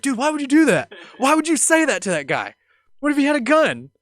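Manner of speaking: high, loud mock outrage; exasperated berating. Friend scolding the joker afterward, retold exasperated yelling. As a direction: berate your reckless friend, acted out.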